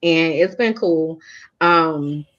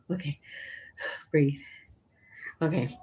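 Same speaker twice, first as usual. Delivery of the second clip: breathy, near-silent okay, fighting tears; steady yourself, barely voiced; real tears held back; choked up; 2 semitones lower, 13 dB quieter than usual. Real, not acted. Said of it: "I'm getting emotional".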